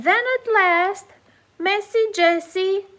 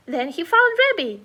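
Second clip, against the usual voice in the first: usual speaking voice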